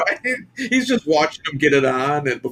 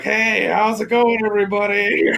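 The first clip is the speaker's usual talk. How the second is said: Gregor's breezy hello; 7 semitones higher than usual. Retold or imitated. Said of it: "he's like".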